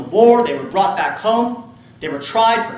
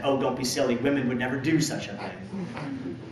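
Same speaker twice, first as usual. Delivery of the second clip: mock British accent; 5 semitones lower, 8 dB quieter than usual. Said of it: "That's not my British accent".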